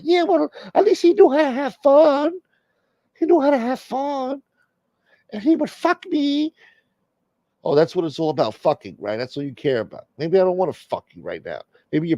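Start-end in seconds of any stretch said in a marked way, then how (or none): 0.0-6.5 hammed-up girlfriend nagging in falsetto; wife's voice. Comic voices Jennifer Lopez nagging Ben Affleck, high mock girlfriend voice.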